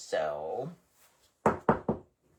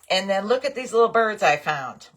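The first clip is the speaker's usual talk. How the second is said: bright burst of delight, a touch higher and louder; excited; 6 semitones higher, 7 dB louder than usual. Real, not acted. Crafter showing viewers her new birds, excited. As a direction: show them off with real glee.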